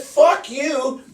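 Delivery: high-pitched retold curse; angry retort. Retold quarrel with the lineman.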